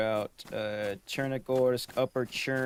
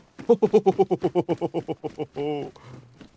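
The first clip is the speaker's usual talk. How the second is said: nervous laughter; 5 semitones higher, 5 dB louder than usual. Real, not acted. Nervous laugh after scare.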